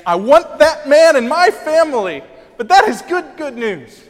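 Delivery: emphatic preaching. Real, not acted. Preaching climax.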